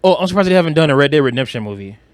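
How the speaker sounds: ordinary delivery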